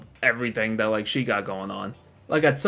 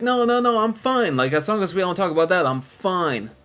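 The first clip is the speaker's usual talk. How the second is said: mock Boogie; 7 semitones higher than usual. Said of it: "And Boogie's like".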